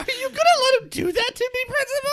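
put-on fast, high complaining child; high whiny kid voice. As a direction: whine at the principal quickly. Kid whines to principal.

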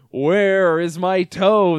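slow, loud spooky moan; loud, slow ghostly groan. A narrator's reading. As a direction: draw it out like a ghost at the door. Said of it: "the voice groaned".

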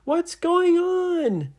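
high, loud, slow call to someone overhead; drawn-out story-hero holler; yelling up. Acted. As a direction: call up into the treetop. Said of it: "he yelled up and he said".

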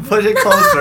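squeaky giggling voice; laughing. Real, not acted. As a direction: laugh through the words. Laughing, high.

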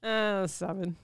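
low, quiet disdain; disdainful. Real, not acted. Co-host scoring a restaurant name she dislikes, disdainful. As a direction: give the score with quiet disdain. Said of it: "She said that with such disdain".

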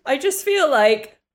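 high, loud, laughing through tears; tearful. Real, not acted. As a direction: struggle to talk as tears take over. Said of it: "I'm about to cry".

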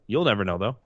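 ordinary delivery

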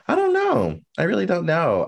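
high-pitched helpless I don't know; squeaky high. Real, not acted. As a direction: squeak it out in mock despair. Unsure of own vision.

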